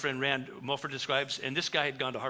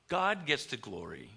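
usual speaking voice

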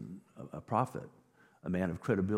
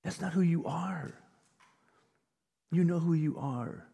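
caring friend; 9 semitones higher than usual. Retold or imitated. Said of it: "your friends will say".

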